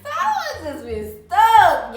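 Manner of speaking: laughing out loud while telling the story. Real, not acted. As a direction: burst out laughing mid-sentence. Laughing at her own story.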